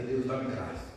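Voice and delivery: low-pitched murmured plea to God; humble prayer. Acted. Believer asking God for grace, humble low prayer. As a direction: pray it low and modestly. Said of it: "Você vai orar".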